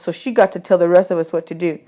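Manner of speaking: ordinary delivery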